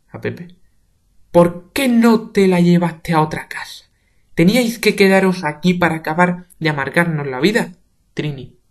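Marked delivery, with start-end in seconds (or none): 1.3-7.7 higher, much louder rage; shouted reproach; furious. Acted. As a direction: lash out at him. Father raging at Pepe.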